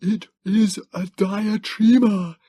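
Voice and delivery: high, slow, strained gasp. Acted. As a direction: gasp it out in pain. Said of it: "he gasped".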